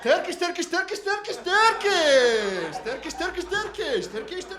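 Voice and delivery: high-pitched, loud mock-Turkish chatter; hammed-up excited shopkeeper babble, last word stretched; imitated Turkish babble. Doner guy takes comic for a Turk, overjoyed babble. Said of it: "The guy thinks I'm a Turkish person".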